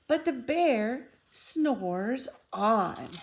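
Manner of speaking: slow, drawn-out storybook refrain. A narrator's reading. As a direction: stretch each word as the bear sleeps. Refrain read slowly.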